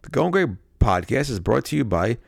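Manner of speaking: hammed-up commercial read; announcer-style ad voice; mock ad read. Reads a mock ad.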